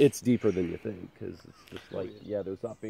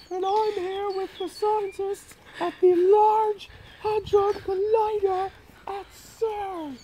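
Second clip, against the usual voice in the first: very high, slow presenter voice; cheery high TV host; slower than usual. Retold or imitated. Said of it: "another one that he did".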